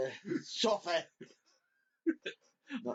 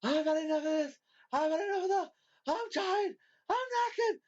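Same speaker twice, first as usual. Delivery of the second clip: imitating a tired rant that climbs higher each line; mock rant building higher; 11 semitones higher than usual. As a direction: pitch climbing from fed-up to whiny peak. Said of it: "you're like".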